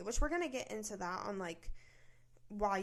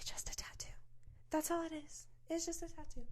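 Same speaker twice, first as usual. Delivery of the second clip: whispering; 6 semitones higher, 5 dB quieter than usual. Real, not acted. Whispered insistence that it's only a tattoo.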